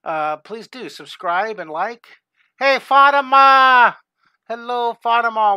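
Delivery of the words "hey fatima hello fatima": long, loud, slow call of a name. Real, not acted. Teacher calling out to a returning student. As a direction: call out a warm hello across the room. Greeting a student in live chat.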